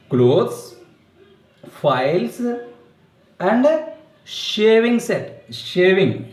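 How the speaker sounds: shaving set said very slowly, each word drawn out; slow clear teaching. Shown as a demonstration. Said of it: "Children, remember".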